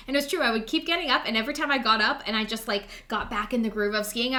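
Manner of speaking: usual speaking voice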